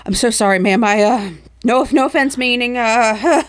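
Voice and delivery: naked man apologizing to Moira, flustered; flustered apology. Acted. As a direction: fumble the sorry in embarrassment. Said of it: "He's like".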